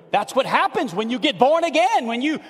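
high, loud fervent preaching; full-force preaching. Acted. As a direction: lift them up, building fire.

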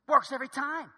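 sarcastic. Acted. Wife teasing husband after his plan failed, sarcastic. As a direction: needle him with mock admiration. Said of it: "she says in a sarcastic tone".

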